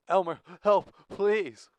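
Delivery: high, loud panicked cry for help; terrified plea. Acted. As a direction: cry out desperately for rescue. Dale terrified, pleading for help.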